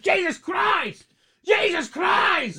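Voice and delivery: high, very slow mock shout, stretched out; imitating Fury's brother. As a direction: drag out each Jesus Christ like a stubbed toe. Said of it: "He's like".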